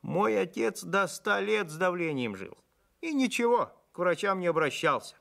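a hint of a proud little old man. A narrator's reading. Wizened farmhand bragging about his father, lightly voiced old man. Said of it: "сухонький старичок".

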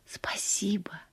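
soft, hushed thanks, narrated; whispered. A narrator's reading. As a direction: drop to a near whisper. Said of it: "шепнула". (тихо, почти шёпотом)

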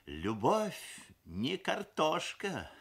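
mildly wistful, slow resignation; sighing. A narrator's reading. Mother after the groom fled, sighing. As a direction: let it out slowly with a touch of regret. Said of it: "вздохнула мамаша".

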